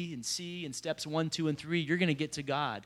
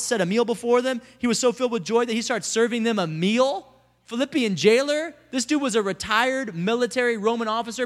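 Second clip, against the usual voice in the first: pitched-up, loud amazed preaching; full-force preaching; 5 semitones higher, 6 dB louder than usual. Real, not acted. Preacher marvels at the brutal jailer serving a meal, impassioned preaching. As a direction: drive it home with astonishment.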